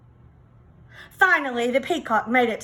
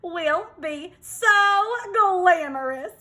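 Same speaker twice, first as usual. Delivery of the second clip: drawn-out, higher vain hens; preening hens; 7 semitones higher than usual. Acted. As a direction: savour it like a diva.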